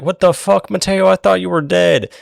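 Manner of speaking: high, loud acted disbelief; shocked yell. Darrow finds Mateo alive, loud high shock. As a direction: exclaim it like seeing a dead man alive.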